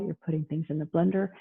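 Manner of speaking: ordinary talk, nothing marked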